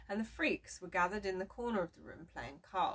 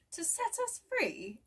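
Francis, amazed; 11 semitones higher than usual. A narrator's reading. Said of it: "asked Francis".

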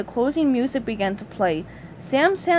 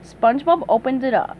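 ordinary delivery, nothing marked

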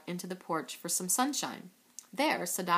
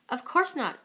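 a firm denial, raised up; 7 semitones higher, 7 dB louder than usual. A narrator's reading. The nurse firmly denying Sadako's fear of dying, raised voice. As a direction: shut the worry down firmly. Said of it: "answered with a firm shake of her head".

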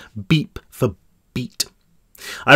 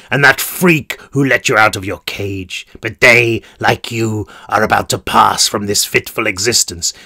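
sinister warden; 3 semitones lower than usual. Acted. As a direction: purr it like a cruel warden. Warden speaks to captive.

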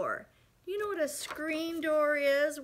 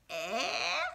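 deaf granny eh; 3 semitones lower than usual. Acted. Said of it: "That's what letter E says".

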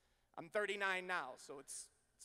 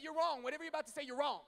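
insistent protest; 7 semitones higher, 4 dB louder, faster than usual. Retold or imitated. Insisting to dad, retold.

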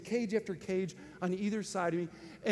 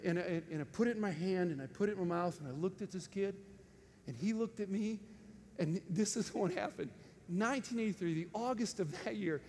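ordinary delivery

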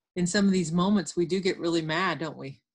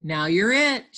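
raised triumphant read-aloud shout; louder playground announcement; 2 semitones higher, 4 dB louder than usual. Acted. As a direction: call the tag out loudly. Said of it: "shouted Fritz".

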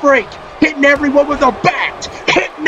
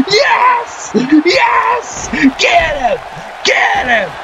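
high cheering yells; root for him at the top of your voice; excited cheering; 8 semitones higher, slower than usual. Real, not acted. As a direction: fan urging a wrestler on. Cheering on the Freak.

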